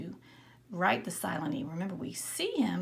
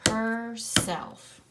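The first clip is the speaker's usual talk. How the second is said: "Herself" louder, split into her and self; clapping syllables; 3 semitones higher, 5 dB louder than usual. Shown as a demonstration. Teacher clapping out herself with the class. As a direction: clap her, self loudly. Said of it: "let's clap out those syllables".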